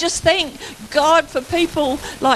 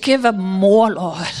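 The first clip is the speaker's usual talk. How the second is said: low, insistent mock prayer; deep retold prayer, dropping away; imitated praying minister; 6 semitones lower than usual. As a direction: mimic a minister pressing on regardless. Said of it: "And he said".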